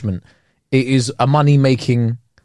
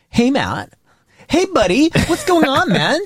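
high, chummy mock American; American accent; 9 semitones higher, slower than usual. Brit around Americans starts copying them, high-pitched American accent. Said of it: "when you're around so many Americans".

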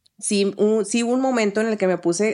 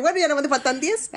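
laughing; 6 semitones higher than usual. Retold or imitated. Joking reply about age.

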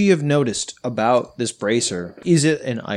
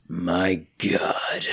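dramatic gasp; 7 semitones lower, 7 dB quieter than usual. Acted. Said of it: "slowly pulls off his glasses".

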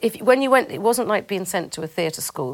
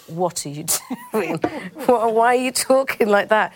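laughing through a mock-baffled question, rising louder; amused mum's bafflement; 4 semitones higher, 5 dB louder than usual. Mum on the phone about the new vowels, laughingly baffled. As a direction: imitate a baffled mum, higher and louder by the end. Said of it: "She just said".

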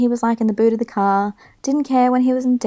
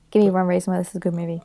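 fast, pushy demand for a reason; rapid challenge; 3 semitones lower, much faster than usual. Real, not acted. Co-host challenges the other to defend the film, fast and pushy. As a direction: fire the challenge off quickly.

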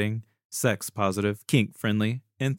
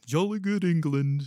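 higher-pitched, badly faked English accent; 5 semitones higher than usual. Host jokingly placing South End, bad English accent. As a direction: put on a terrible jolly English accent. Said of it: "my worst English accent attempt ever".

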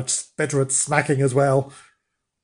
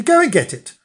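cross order; 9 semitones higher, 5 dB louder than usual. Acted. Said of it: "said Missus Flange crossly".

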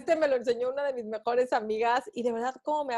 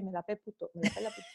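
much softer, repeated under the breath; soft lower self-pep chant; muttered mantra; 5 semitones lower, 9 dB quieter, faster than usual. Retold or imitated. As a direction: mutter it to yourself before the doors open. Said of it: "y yo estoy".

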